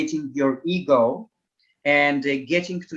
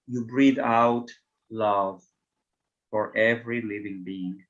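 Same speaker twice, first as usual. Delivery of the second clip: measured meditation voice with long pauses, settling lower; guided meditation; 3 semitones lower than usual. Acted. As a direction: lead them calmly, phrase by phrase.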